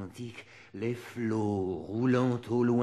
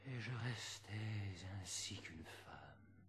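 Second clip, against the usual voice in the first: soft unvoiced whisper, far softer; 4 semitones lower, 17 dB quieter than usual. Reciter to listeners, hushed whispered confession. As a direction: fade to a breath of confession.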